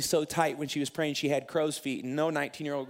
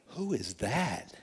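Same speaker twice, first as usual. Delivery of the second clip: softer, slow, dreamy wonder; smitten awe; 4 semitones lower, 5 dB quieter, much slower than usual. Retold or imitated. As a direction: let it melt out, half laughing. First sight of future wife.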